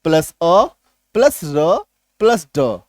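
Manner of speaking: "प्लस अ", "प्लस र", "प्लस ड" slowly split apart; spelling out each sound one by one. Shown as a demonstration. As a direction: break the word into its sounds. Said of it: "यहाँ पे देखिए".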